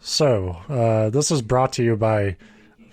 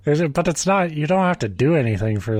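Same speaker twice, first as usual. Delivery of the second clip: ordinary talk, nothing marked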